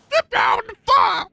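high shouted rock vocal imitation. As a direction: yell it like a rock frontman. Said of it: "I'm good at imitating musicians".

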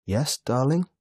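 lightly voiced attentive mother; mother answering. A narrator's reading. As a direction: answer a child with a motherly lilt. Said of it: "his mother said".

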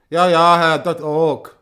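loud, broad fisherman's voice; rough fisherman. A narrator's reading. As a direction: answer like a coarse fisherman, loud. Fishermen answer the abbot. (lauter, derber Fischer)

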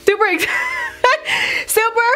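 high, giggly burst breaking the word; laughing excitedly. Real, not acted. Telling viewers about the gift, giggling with excitement. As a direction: laugh through the words in excitement. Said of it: "freaking excited".

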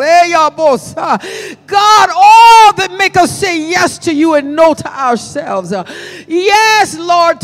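loud, high, crying-out prayer at full force; fervent shouted prayer. Real, not acted. Preacher crying out to God for fresh oil, shouting. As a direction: pour it out like a preacher in revival.

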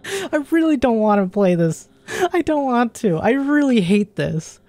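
ordinary talk, nothing marked

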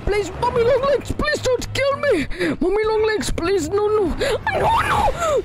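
panicked pleading. Real, not acted. Begging monster not to kill.

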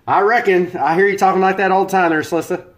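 loud, high, folksy put-on voice; put-on talking style. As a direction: ham up a folksy character, loudly. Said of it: "I just like to talk like that".

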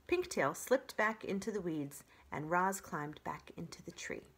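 ordinary delivery

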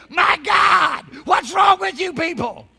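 high, loud exasperated cry; imitating Jack Cole. Preacher quoting Jack Cole to the congregation, exasperated shout. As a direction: belt it like the old preacher did. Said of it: "as Jack Cole used to say".

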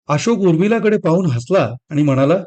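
usual speaking voice

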